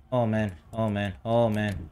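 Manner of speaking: usual speaking voice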